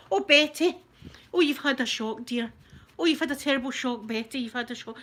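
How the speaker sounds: hammed-up oh-you-poor-thing; fussing sympathy. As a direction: cluck over her like concerned neighbours. Neighbours fussing over shocked Betty.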